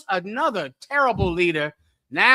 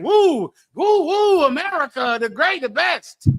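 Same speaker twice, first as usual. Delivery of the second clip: high hammed-up woo, woo cheer; mock cheering; 6 semitones higher than usual. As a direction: cheer like a gung-ho fan, mocking. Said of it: "I said".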